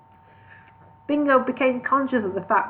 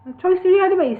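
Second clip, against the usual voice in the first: higher Birmingham voice for Bingo; Birmingham accent; 10 semitones higher, 6 dB louder, faster than usual. Acted. Bingo restarting the line in Birmingham accent. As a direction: Brummie voice, louder.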